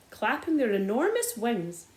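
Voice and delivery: plain reading voice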